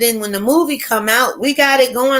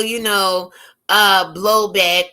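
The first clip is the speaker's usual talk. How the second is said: ordinary talk, nothing marked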